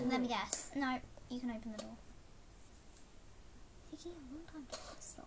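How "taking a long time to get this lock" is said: fast unvoiced whisper. Real, not acted. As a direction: mutter it under your breath. Fiddling with lock.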